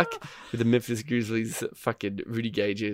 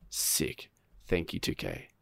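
much softer, dry and deadpan; sarcastic thanks; 8 semitones lower, 12 dB quieter, slower than usual. Real, not acted. Gamer bitterly thanking 2K, soft deadpan sarcasm.

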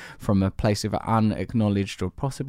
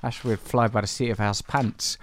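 normal talking voice

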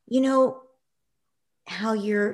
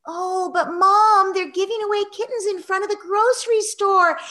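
put-on high, excited whining kid; revved up and whiny; 14 semitones higher, 7 dB louder than usual. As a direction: whine like an eleven-year-old begging. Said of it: "in this voice that's, um, very revved up, but also very whiny".

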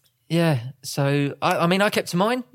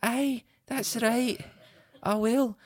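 slow, higher-pitched mock Scottish agreement; badly faked Scottish wife voice; Claire; 6 semitones higher, slower than usual. Husband voices his wife giving in, bad Scottish accent. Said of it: "that is the worst Scottish accent".

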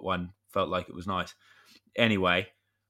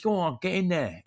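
pitched up high, pushy retold urging; urging dad on; 10 semitones higher than usual. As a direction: shove him through the door with your voice. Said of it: "I said".

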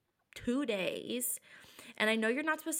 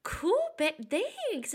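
mock cheery; 9 semitones higher, slower than usual. Fake-cheerful reply to boyfriend.

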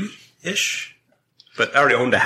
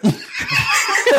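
shrieking laugh at his own punchline; real laugh at the story's twist; high burst of laughter; 3 dB louder than usual. Real, not acted. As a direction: crack up, way up high.

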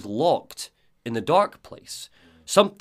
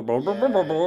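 muffled wah-wah babble, pitched up; unintelligible cartoon parent; 5 semitones higher than usual. Comparing it to parents in Charlie Brown, unintelligible babble. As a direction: imitate garbled grown-up talk. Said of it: "you can't understand what they're saying".

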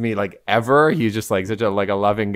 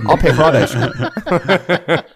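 higher, slow line breaking into laughter; laughing boast; 4 semitones higher, slower than usual. Retold or imitated. Rich uncle treating niece's friends, laughing boast. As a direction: brag through a laugh, drawing it out.

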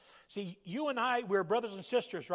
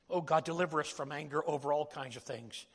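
ordinary talk, nothing marked

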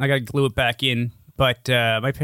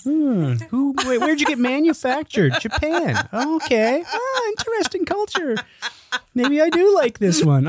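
mock flirty interview with vibrator; 11 semitones higher than usual. Voicing herself quizzing the vibrator.

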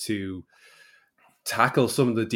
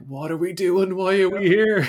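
high-pitched, raised voice of complaint; mock dismay; 9 semitones higher, 6 dB louder than usual. Hikers questioning themselves near the summit, in high mock dismay. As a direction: imitate bewildered hikers wailing at their plight.

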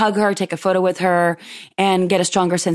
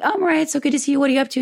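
much higher, bright delighted hello; delighted greeting; 8 semitones higher, much faster than usual. Retold or imitated. Greeting a friend at a brunch, much higher delighted voice. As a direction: gush at an old friend.

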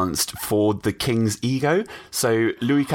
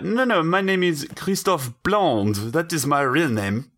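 slow, hammed-up French accent, pitched up; mock French accent; 5 semitones higher than usual. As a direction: insist in a fake French accent. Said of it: "it was like".

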